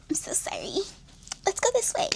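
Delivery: whispered then squeaky high apology; mocking the sweetly sorry reply; mock meek apology. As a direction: sarcastically play the timid pushover. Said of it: "you not finna just be like".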